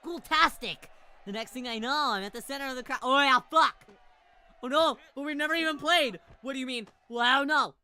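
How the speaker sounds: real startled yelling; alarmed shouting. Real, not acted. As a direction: shout it in rising disbelief. Streamer panics as game event starts.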